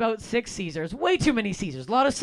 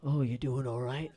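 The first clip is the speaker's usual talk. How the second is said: much lower, softer worried voice; low concerned Ross; 10 semitones lower, 9 dB quieter than usual. Retold or imitated. Ross checks on the fallen comic, low and concerned. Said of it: "He's like".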